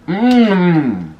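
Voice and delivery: long, high, loud yummy hum; savouring mmm. Real, not acted. Enjoying a good meatball on camera, savouring mmm. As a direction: draw out a hum of pleasure, falling at the end. Savouring food during a mukbang.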